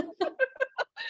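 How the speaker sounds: a sudden high laugh; high burst of laughter. Real, not acted. Laughing at the remark.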